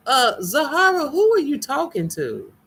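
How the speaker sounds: high, loud disbelieving call-out; high-pitched who-are-you-talking-to; incredulous. Real, not acted. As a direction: demand to know who, stunned. Reacting to a chat name.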